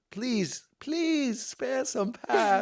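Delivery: high, very slow, drawn-out plea; sarcastic, overly polite begging; mock pleading. Hiker to a group blocking the path, mock pleading. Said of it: "I'll do, like, the, what".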